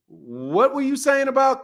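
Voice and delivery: high-pitched challenge from a wife; imitating his wife. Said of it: "My wife ... opened my door and was like".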